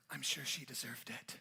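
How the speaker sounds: low, hushed aside into his ear; whisper. Acted. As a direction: lean in and breathe it like a secret. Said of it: "leans into his ear and whispers".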